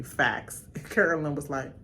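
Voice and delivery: normal talking voice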